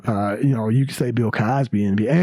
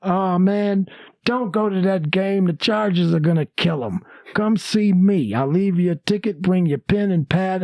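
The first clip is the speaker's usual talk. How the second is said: Cosby voice; 6 semitones higher than usual. Retold or imitated. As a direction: talk like Cosby inviting him over. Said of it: "He goes".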